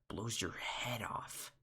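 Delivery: breathy slow whisper of a bug. Acted. Bug whispering to his friend. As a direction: hush it out, slow and voiceless. Character line in bug story.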